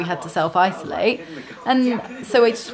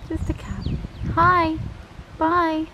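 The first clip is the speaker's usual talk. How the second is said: cat talk voice; 8 semitones higher, slower than usual. Real, not acted. Talking to a cat in car park.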